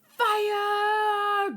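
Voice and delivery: high, long-drawn battle shout; loud commander's cry, stretched out; shouted command. Acted. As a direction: yell the order to open fire. Officer orders troops to fire.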